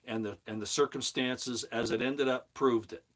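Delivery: usual speaking voice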